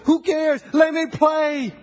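high, slow, pleading shout; preaching at full force. Acted. Preacher urging church to stop being fans, pleading preach. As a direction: beg the coach to let you play.

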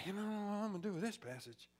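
ordinary talk, nothing marked

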